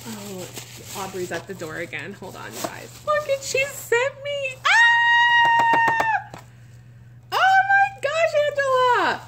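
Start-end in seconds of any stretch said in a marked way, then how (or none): 2.1-3.0 quick whisper. Real, not acted. Aside while someone's at door. 3.1-9.2 high, loud delighted shriek that races at the end; squealing with joy. Real, not acted. Squealing over gift, then door.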